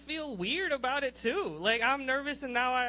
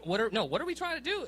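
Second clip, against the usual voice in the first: ordinary talk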